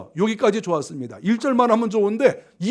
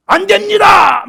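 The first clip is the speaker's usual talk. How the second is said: high, loud objection shouted out; protesting; 11 semitones higher, 12 dB louder, slower than usual. Acted. Disciple talks back to his teacher, shouting in protest. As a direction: burst out in defiant refusal. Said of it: "항변하여".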